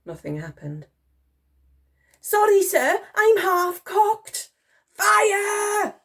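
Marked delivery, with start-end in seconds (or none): 2.2-4.5 high, loud soldier's apology; panicky apology. A narrator's reading. Soldier excusing a misfire to the Admiral, panicky. 4.9-5.9 high, loud shout to fire; shouted command. A narrator's reading. Admiral impatient with the private, shouted command. Admiral repeats firing order.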